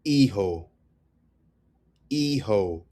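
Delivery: Hijo said ee-ho; no H before the ee in "Hijo"; silent H. Shown as a demonstration. Teacher repeating the word for son, "Hijo" with silent H. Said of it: "It's not he-ho".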